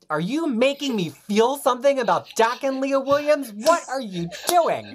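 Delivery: high, loud incredulous outburst; mock disbelief. Reader confronting the writer over a problematic fave, incredulous. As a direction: demand answers in astonished protest, pitched high.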